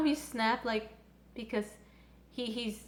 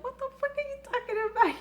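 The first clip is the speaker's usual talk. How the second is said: much higher, fast incredulous retort; indignant Victor; 13 semitones higher, faster than usual. Retold or imitated. Victor answering Yuri's claim he can't do arrows, high fast incredulity. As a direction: fire it back in disbelief.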